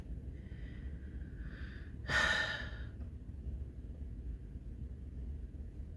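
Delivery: a long, drawn-out unvoiced sigh. Stunned by grandma's death news, heavy sigh. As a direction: let all the air out in a heavy sigh. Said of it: "And I just was like".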